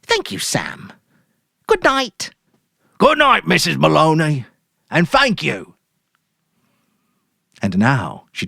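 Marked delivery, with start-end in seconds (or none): 0.0-2.3 higher, smiling put-on thanks; big forced smile. Mary thanking the grocer, with a big forced smile. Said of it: "put on her biggest smile". 3.0-5.8 hearty grocer goodbye. Acted. Sam saying goodbye to Mary, hearty.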